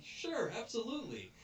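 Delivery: very high, obliging reply; high-pitched, hammed-up yes; mock eager agreement. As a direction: play over-willing thugs agreeing. Acted thugs eagerly agreeing.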